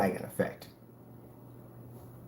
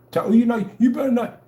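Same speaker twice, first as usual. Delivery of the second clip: mock threat; 10 semitones higher, 5 dB louder than usual. Mocking the critic's threat.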